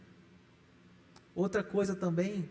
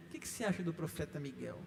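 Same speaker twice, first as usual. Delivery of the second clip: the same question again, softer and faster, half amused; mock repeat; 4 semitones lower, 7 dB quieter, faster than usual. Pastor echoing the question with amused disbelief, softer and faster. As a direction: toss the question off quickly under the breath.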